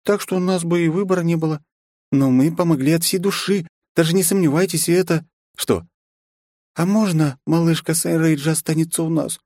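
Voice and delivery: high coaxing vampire voice; sweet pleading lady. Acted. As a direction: beg sweetly.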